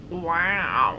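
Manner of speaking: low mock purr of "Wow"; purring like a cat. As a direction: imitate Ari purring at Chelsea, low. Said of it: "he purred like a cat".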